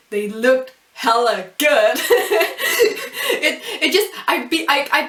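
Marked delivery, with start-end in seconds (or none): 2.0-3.3 high giggling laugh bursting out; burst of laughter. Real, not acted. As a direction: let a real high laugh spill out. Reacting to the anime.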